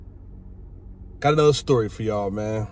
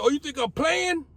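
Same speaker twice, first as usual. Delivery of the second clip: defiant challenge; 13 semitones higher than usual. Retold or imitated. High retold challenge to guards.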